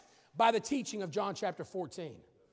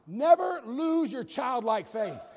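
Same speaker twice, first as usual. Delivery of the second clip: slowed, each word weighted and louder; slow emphasis; 5 dB louder, much slower than usual. Real, not acted. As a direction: press every word home. Preacher stresses key line.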